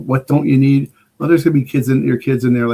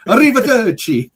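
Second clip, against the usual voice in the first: high, hammed-up Italian farewell; pitched-up fake Italian flourish; mock Italian; 9 semitones higher, 4 dB louder than usual. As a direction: imitate a bad Italian speaker. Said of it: "thinks he speaks Italian".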